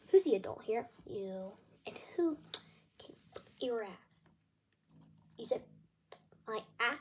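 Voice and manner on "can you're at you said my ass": ordinary talk, nothing marked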